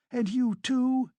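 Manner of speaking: high, drawn-out accusation; agitated woman, pitched right up; outraged Katerina. A narrator's reading. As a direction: turn on her in indignant disbelief.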